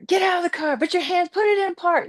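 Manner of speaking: high, barked police orders; police commands. Retold or imitated. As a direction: shout commands like armed cops. Police with guns drawn.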